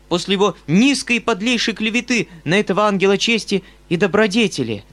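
high, loud, urgent pleading; uncle pleading. A narrator's reading. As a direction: press his case hard and fast. Uncle pleading with his mother.

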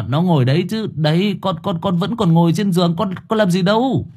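high, stammering self-defence; flustered protest. Acted. Son swears to his mother he did nothing, flustered. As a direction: stumble over the words, pitch climbing.

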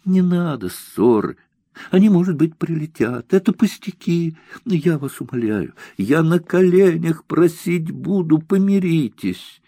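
high, tearful begging; a girl on the edge of tears begging; pleading. Acted. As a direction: implore them to make peace. Girl begs officers not to duel.

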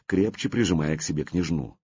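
ordinary delivery

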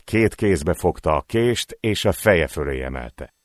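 usual speaking voice

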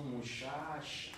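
muchacha with soft sh sounds, "mushasha", northern Mexican style; northern Mexican accent: muchacha as mushasha. Shown as a demonstration. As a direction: say muchacha like a norteño. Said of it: "Yo como norteño mexicano".

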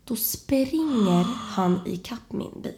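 ordinary delivery

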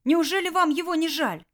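put-on boy begging the bandit for mercy; tearful plea. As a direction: plead through tears. Kirill pleads through tears.

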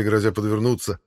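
ordinary delivery, nothing marked

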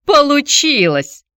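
high, slow sneering echo; mocking mimicry. A narrator's reading. Antonina mimics her ex-husband, high slow mocking echo. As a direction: throw his word back with scorn. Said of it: "передразнила Антонина бывшего мужа".

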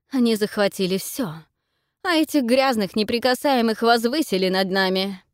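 loud, high furious tirade; angry. A narrator's reading. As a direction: rage against the others.

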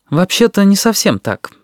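polite, high-pitched objection. A narrator's reading. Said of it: "вежливо сказал Сенцов". (вежливое возражение высоким голосом)

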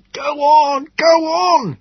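high, loud desperate yell; shouting. Acted. Man with a dresser on his cycle shouting at the crowd ahead, loud. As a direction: bellow at the crowd. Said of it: "he cried to those ahead of him".

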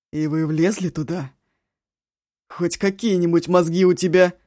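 громко, с упрёком; отчитывай криком; крик брата. Acted. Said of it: "Не кричи ты". (громкий упрёк)

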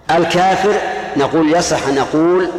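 ordinary talk, nothing marked

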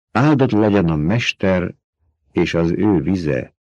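usual speaking voice

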